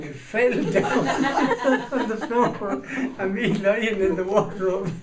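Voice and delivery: high, loud words through laughter; laughing. Real, not acted. Teller laughing as the wardrobe falls over.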